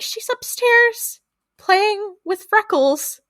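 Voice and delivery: high, slow singsong imitation of a mother; mother putting on cheer. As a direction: draw it out high, keeping up appearances. Voicing the frightened mother.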